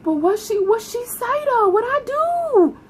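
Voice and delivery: rising to a high disbelieving squeak; shocked high squeak. Retold or imitated. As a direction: ask it in high-pitched disbelief. Retelling her own shocked reply.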